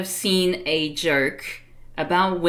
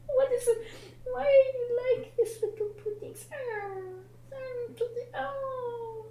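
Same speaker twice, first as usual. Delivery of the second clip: high, soft, crying-cute coo; 6 dB quieter than usual. Real, not acted. Owner coos high and soft at her dog, baby talk. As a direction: melt over the little pudding.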